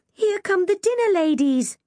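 gasp, then high-pitched warning; alarmed warning. A narrator's reading. Jazz warning the others of dinner ladies, alarmed. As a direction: warn of trouble arriving. Friend warns adults are coming.

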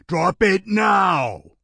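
loud, slow roared command. A narrator's reading. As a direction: roar it across the room. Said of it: "Jonathan roared".

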